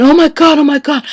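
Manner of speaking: panicked sister. Retold or imitated. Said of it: "she's out of her mind".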